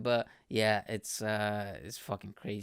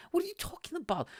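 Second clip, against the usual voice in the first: much higher, baffled disbelief; incredulous; faster than usual. Retold or imitated. Retelling a date's bill confusion, incredulous high pitch. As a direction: throw the question up in total disbelief. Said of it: "It's like".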